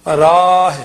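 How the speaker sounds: ਰਾਹ loud, with held aa; long vowel. Shown as a demonstration. Teacher drilling vowel length, ਰਾਹ with long aa. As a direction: stretch the aa of ਰਾਹ. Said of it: "ਕੰਨਾ ਲੰਮਾ".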